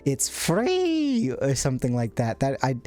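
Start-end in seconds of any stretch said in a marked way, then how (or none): 0.0-1.3 very high, squeaky mock voice; Antra's high-pitched voice. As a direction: imitate a pitched-up streamer, stretching "free" up high. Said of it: "pitching his voice high".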